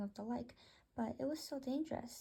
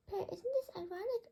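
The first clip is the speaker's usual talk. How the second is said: much higher, teasing question rising at the end; teasing bird voice; 8 semitones higher than usual. Acted. One bird teasing the other about being scared, high teasing bird voice. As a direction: poke fun at your friend in a squeaky bird voice.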